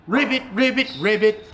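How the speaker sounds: ordinary talk, nothing marked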